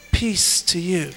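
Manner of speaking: low, soft, slow blessing; slow tender voice of Jesus; calm peace. Retold or imitated. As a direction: speak peace gently, like Jesus calming them. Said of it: "Jesus is saying".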